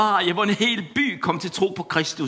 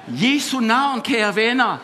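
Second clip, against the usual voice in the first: preaching; 6 semitones higher than usual. Acted. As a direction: stir the congregation up. Preaching climax.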